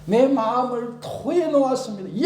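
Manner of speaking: crying confession. Retold or imitated. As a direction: cry it out. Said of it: "그렇게 얘기하잖아요". (외치듯 토로)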